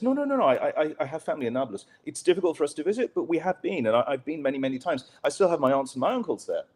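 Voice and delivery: high-pitched, hurried retold protest; earnest protest. Colleague assuring the suspicious gunman of family in Nablus, high earnest protest.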